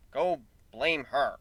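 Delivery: ordinary delivery, nothing marked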